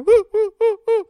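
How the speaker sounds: very high, loud teasing yelps; mocking yelps. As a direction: kids copying the yelps to tease. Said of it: "were mocking me".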